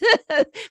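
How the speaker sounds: high, loud burst of laughter. Real, not acted. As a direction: laugh out at the punchline. Laughing at the quote.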